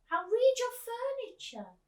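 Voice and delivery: put-on high, slow, loud old lady. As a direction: draw it out like a deaf old actress. Miss Spink's slip, high and slow.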